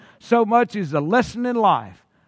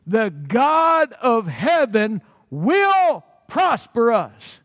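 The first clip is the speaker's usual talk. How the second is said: slow proclamation; much slower than usual. Acted. Nehemiah's reply proclaimed slowly.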